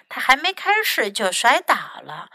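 usual speaking voice

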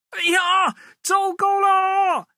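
very high, loud, slow wail of alarm; panicked cry. Acted. Woodcutter trapped in a runaway egg, high panicked wail. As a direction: cry out in panic as you fall.

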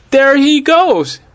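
high, drawn-out excited yell; high-pitched story-time holler. A narrator's reading. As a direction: yell it high and slow, pointing out the runaway. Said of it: "yelled Tess".

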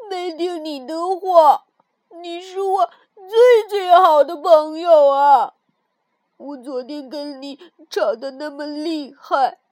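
loud, slow tearful pleading; crying apology. Acted. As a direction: weep it out, sorry and grateful. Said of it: "跳跳放声大哭起来".

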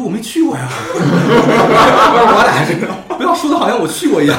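笑着说. Retold or imitated. 笑着转述回答海关.

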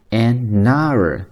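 "an hour" slowly as a-nour; slow teaching demo of "an hour", silent h; linked reading. Shown as a demonstration. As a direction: carry the n of "an" into "hour". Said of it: "它也会连在一起".

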